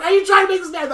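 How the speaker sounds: shrill mock outrage; incredulous retort. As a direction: call him out, shocked.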